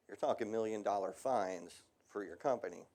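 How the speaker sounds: ordinary talk, nothing marked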